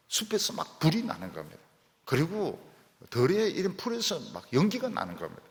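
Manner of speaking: ordinary talk, nothing marked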